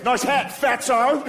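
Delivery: high, drawn-out Australian heckle; Australian goth. Retold or imitated. Goth passerby heckling the comic's hat, mock Australian jeer. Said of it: "he's a goth from Australia".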